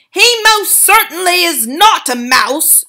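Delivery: loud, high, slow denial; furious father; shouting. A narrator's reading. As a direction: shout him down. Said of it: "shouted Mister Jenkins".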